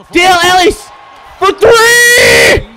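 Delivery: high, loud cry on the shot; excited yell. Real, not acted. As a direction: belt it out as the ball drops. Big shot, yelling at screen.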